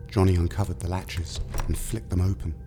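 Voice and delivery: ordinary talk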